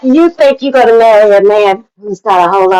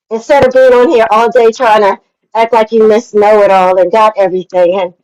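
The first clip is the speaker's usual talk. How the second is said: ordinary delivery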